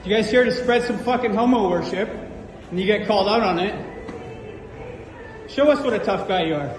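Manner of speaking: ordinary talk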